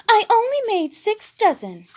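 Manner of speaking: high, loud indignant whine; high diva huff; huffing Piggy. A narrator's reading. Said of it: "huffed Miss Piggy".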